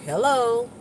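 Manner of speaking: restrained hello, only trying to be nice; politely unenthused. Shown as a demonstration. Greeting someone unwelcome, forced-nice restraint. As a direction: say hello without being happy to see them. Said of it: "I'm trying to be nice. I really ain't".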